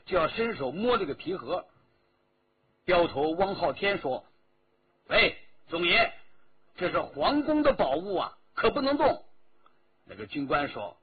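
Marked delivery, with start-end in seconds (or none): 5.1-9.2 loud, high urgent warning. A narrator's reading. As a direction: stop him before he touches it. Escort chief warns officer off.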